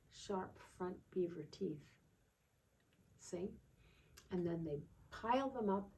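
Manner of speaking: plain reading voice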